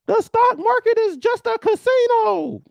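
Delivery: high, slow, loud mocking voice; mock doomsayer. Mocking a skeptic viewer, high and loud. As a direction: ham up a skeptic's scoffing line. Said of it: "why did I use all the voices".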